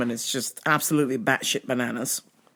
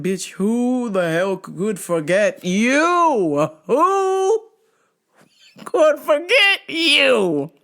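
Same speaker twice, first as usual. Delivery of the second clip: sarcastic; 7 semitones higher, 4 dB louder, much slower than usual. Retold or imitated. Clerk inner sarcasm.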